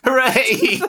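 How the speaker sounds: high-pitched spectator's hooray; mock cheer. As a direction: cheer like a fan as the car passes. Acting out spectators cheering a car.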